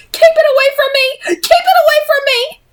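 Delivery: high screaming panic, crying out; a delirious brother screaming in fear; terrified scream. A narrator's reading. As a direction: shriek it in pure terror. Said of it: "he screamed".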